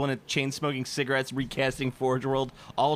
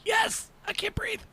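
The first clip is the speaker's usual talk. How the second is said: wheezy whisper. Pretend. Wheezing reply in skit.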